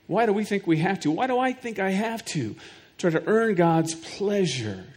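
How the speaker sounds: ordinary talk, nothing marked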